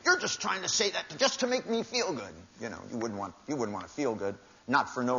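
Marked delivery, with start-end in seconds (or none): 0.0-2.3 high mocking whiny voice; lightly squeaky mock complaint; mocking whine. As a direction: lampoon the wounded spouse. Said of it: "people say stuff like that".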